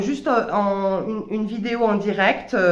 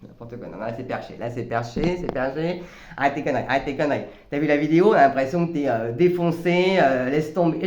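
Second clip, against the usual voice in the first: scornful ego voice; 7 semitones lower than usual. Retold or imitated. Mocking her own dancing video, in a low scornful ego voice. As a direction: dismiss yourself like a cynical inner critic. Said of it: "vous entendez comment je me parle à ce moment-là".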